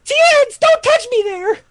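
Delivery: very high, squeaky protest; Chaozu. Acted. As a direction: squeal it like a tiny anime character.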